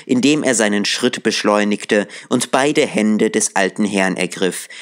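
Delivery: ordinary delivery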